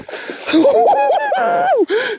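long, very high victory whoop; triumphant whoop. Real, not acted. Angler celebrating the landed fish, elated whoop. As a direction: whoop it out in real elation.

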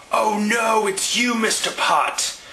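high, loud, slowed-down shocked cry; shocked shout. Acted. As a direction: gasp out the discovery, then shout. Officer discovers the culprit.

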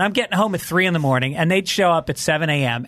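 ordinary talk

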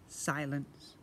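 plain reading voice

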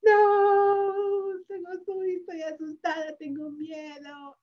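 scared sheep. Acted. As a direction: play the frightened little sheep. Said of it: "Esta oveja está asustada, así".

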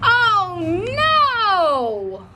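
high, loud alarmed cry; holler. Acted. Lucille hollering when the frank hits the tablecloth. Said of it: "hollered Lucille".

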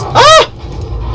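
sudden high shriek of fright; startled scream. Real, not acted. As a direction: jump out of your skin. Enemy jumps at him.

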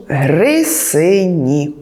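грисині drawn out slowly, each sound clear; slow dictation of грисині; slow, spelled out. Shown as a demonstration. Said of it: "В українській мові пишемо".

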